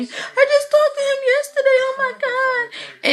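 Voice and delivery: high-pitched, loud tearful wail, put on; mock crying breakdown. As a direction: wail it out, imitating a sobbing assistant. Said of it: "was breaking down, crying".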